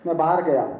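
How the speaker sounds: ordinary delivery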